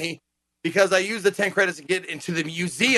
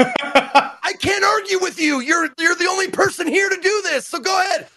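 mock exasperated director; 12 semitones higher, 4 dB louder than usual. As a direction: give in with hammed-up exasperation. Director gives in to amateur actor.

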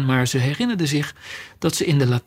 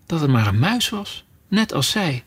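usual speaking voice

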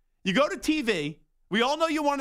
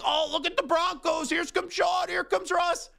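mock cheering fans; 8 semitones higher than usual. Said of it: "they were all like".

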